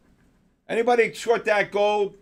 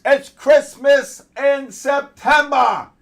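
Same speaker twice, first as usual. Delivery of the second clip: slow, drawn-out and higher, savouring every word; gleeful exclamation; 7 semitones higher, 6 dB louder, much slower than usual. Real, not acted. Streamer gloating to viewers as gold drops, slow drawn-out cheer. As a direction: stretch it out like you can't believe your luck.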